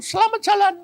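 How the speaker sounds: high, loud called goodbye; comic farewell. Retold or imitated. Preacher sees off his friend's spirit, calling high. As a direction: call out the send-off high and loud.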